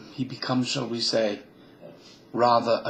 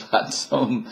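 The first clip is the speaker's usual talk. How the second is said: ordinary delivery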